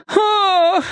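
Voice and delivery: very high anguished "Ah"; despairing moan. A narrator's reading. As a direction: groan out in despair. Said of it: "простонала фройлен Дуля".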